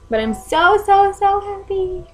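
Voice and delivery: high, slow, overjoyed; so happy it wobbles; emotional. Real, not acted. New mom overjoyed, voice breaking.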